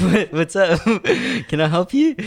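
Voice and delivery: high, loud, laughing retelling; put-on high voice, half laughing; mock startled reply. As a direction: act out a thrown, high-pitched greeting. Retelling nervous reply to strangers.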